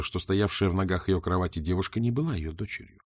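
usual speaking voice

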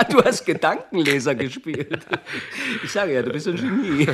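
loud laugh running into the words; delighted, laughing at his trick; laugh, then say it still laughing. Acted. Laughs at the mind-reading trick.

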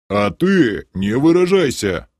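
slow, stern guard; grim guard's warning. A narrator's reading. Guard reproving a swearing bystander, grim warning. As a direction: warn sternly, drawing it out. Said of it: "мрачно посоветовал последний стражник".

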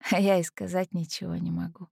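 ordinary delivery

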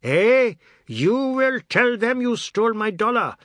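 very loud, high threatening yell; shouting. Acted. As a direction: roar the threat. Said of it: "He was shouting now".

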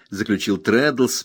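ordinary delivery, nothing marked

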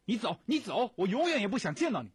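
loud, high, fast angry yelling; furious shout. A narrator's reading. Prisoner yells at his visitor to leave. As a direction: drive her away with a shout.

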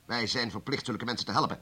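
ordinary delivery

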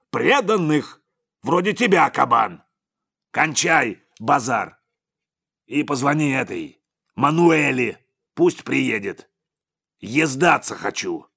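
loud, high-pitched angry tirade, easing slightly; shouting. A narrator's reading. Boss shouts down his bodyguard and gives orders, furious. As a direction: keep bellowing orders at him. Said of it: "закричал Сипякин".

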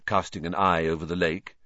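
ordinary delivery

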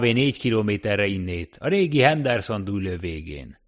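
a wary taxi driver warning a stranger off; cabbie voice. Acted. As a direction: talkative cab driver warning off a newcomer.

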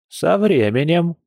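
slow, high-pitched musing reply; lightly voiced granny, drawn out; philosophical. A narrator's reading. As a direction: answer unhurriedly, lightly philosophical. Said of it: "философски отозвалась электростанция Петровна".

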